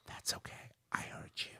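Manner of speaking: very soft whispered reply; imitated whisper. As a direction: whisper it back, voiceless and hushed. Retold reply after being too loud.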